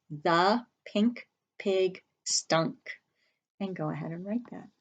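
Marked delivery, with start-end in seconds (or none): 0.1-2.8 very slow read-along; slow choral reading. Shown as a demonstration. Teacher reading slowly along with the students. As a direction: read it slowly together with the class. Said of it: "Say that with me".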